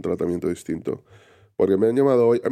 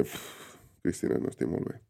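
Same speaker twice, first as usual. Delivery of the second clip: weak, unwell; 2 semitones lower, 11 dB quieter than usual. Real, not acted. Admits he is unwell.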